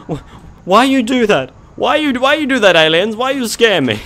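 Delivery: high, loud, whiny scolding of the aliens; playful high-pitched complaint after a fright; mock outrage. Real, not acted.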